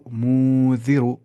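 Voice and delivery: "مو" held long with the noon hidden in the nose; nasal ikhfa. Shown as a demonstration. Teacher models hiding the noon, nasal ikhfa. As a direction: stretch "مو" through the nose before "ذی رو". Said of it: "اسے ناک میں چھپانا ہے".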